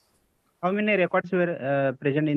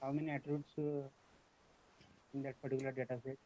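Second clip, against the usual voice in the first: very faint, far from the mic; barely audible; 14 dB quieter than usual. Real, not acted. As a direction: speak so softly it barely carries. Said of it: "your voice is not, uh, clearly audible".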